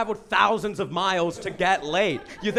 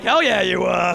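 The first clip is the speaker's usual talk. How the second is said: louder proud boast; cocky brag; 5 dB louder than usual. Retold or imitated. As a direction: crow it like a proud winner. Cocky reply to girlfriend.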